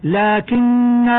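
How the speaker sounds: لكن held with a long final alif, very slow; slow recited stop on لكن; stopping on لكن. Shown as a demonstration. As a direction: end لكن on a drawn alif. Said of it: "ويوقف عليها هكذا".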